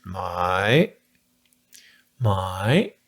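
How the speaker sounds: Thai my with a rising tone, dipping then climbing. Shown as a demonstration. Teacher modelling the Thai question word my with a rising tone. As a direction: say my low then rise it like a bowl. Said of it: "it's a, a rising tone".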